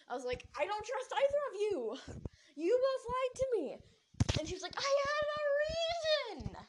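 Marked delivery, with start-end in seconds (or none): 0.6-3.8 high, loud outburst of betrayal; angry accusation. Retold or imitated. Acted quarrel, accusing both friends. 4.8-6.6 high, drawn-out yell in self-defence; yelled protest. Retold or imitated. Acted quarrel, protesting when accused.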